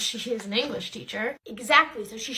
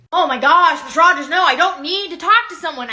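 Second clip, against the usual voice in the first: loud protest; 2 semitones higher, 11 dB louder than usual. Acted. Student protests loudly.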